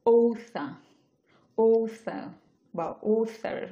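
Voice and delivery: "author" said with the r, then without it. Shown as a demonstration. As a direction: contrast r and no r in "author". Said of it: "র pronounce করলে author হবে". ("author" একবার র দিয়ে, একবার র ছাড়া)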